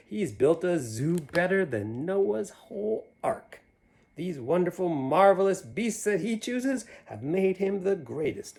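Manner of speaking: lively rhyming storybook read; reading to children. A narrator's reading. Reading a picture book aloud to children, lively. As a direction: bounce through the rhyme for the kids. Reads Seuss rhyme aloud.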